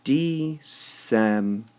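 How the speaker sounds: December slowly split as de, CEM, ber; December, measured out with pauses; syllable by syllable. Shown as a demonstration. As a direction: break December into three separate beats. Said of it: "this word rhymes with".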